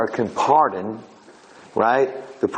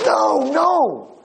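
high, drawn-out acted cry; frantic protest; 13 semitones higher than usual. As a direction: plead desperately through the glass. Husband protests through soundproof window.

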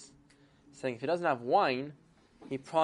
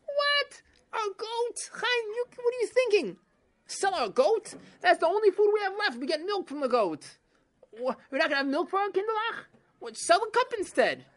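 very high, loud, outraged wife; 5 dB louder than usual. Acted. Wife is outraged Chaim would sell their goat, high shrill protest. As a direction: shriek it in indignation.

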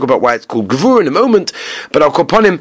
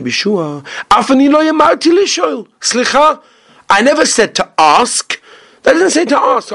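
emphatic reply; 10 semitones higher than usual. Retold or imitated. Rabbi answering his colleague, high insistent protest. Teacher voicing Rebbe Eliezer's reply.

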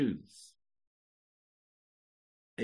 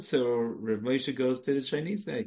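ordinary talk

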